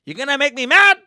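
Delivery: loud, high-pitched mock dad rant; scolding dad. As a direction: shout down an overexcited kid. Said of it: "my dad would just bring me back down".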